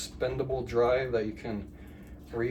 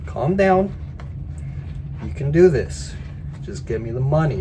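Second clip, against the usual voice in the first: calm him down while pushing for cash; the scammer insists loudly to the victim that he calm down; mock soothing scammer; 3 semitones higher, 7 dB louder than usual.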